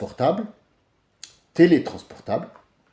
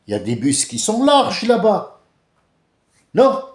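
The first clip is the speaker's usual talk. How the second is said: higher, louder mocking tirade; imagined rant; 4 semitones higher, 6 dB louder than usual. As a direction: berate her sarcastically, pushing higher. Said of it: "j'aurais bien voulu lui dire". (tirade moqueuse imaginée, plus aiguë et plus forte)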